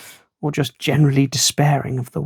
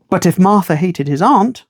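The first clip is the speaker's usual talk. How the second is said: ordinary delivery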